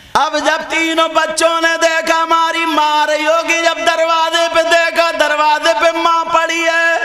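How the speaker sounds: impassioned religious storytelling; fervent preaching. A narrator's reading. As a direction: preach the story at full force to the crowd.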